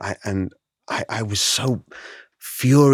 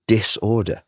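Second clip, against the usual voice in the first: ordinary delivery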